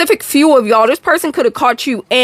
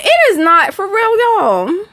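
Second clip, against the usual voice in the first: playful protest; 8 semitones higher, slower than usual. Acted. Protesting her accent is mild.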